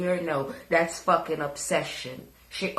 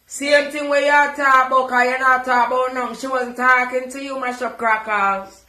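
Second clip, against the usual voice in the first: loud retort; 4 semitones higher, 7 dB louder than usual. Real, not acted. Loud retort to the other speaker.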